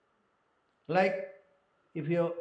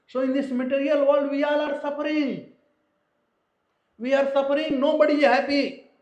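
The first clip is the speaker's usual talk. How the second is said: raised, louder, hammering the point home; emphatic preaching; 8 semitones higher, 7 dB louder than usual. Real, not acted. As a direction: preach it higher and louder to the hall. Preacher stresses universal suffering.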